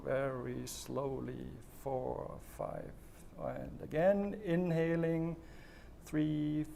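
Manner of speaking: slow, soft breathing-exercise guidance with long pauses; guided breathing. Shown as a demonstration. Coach leading viewers through slow breathing, calm and soft.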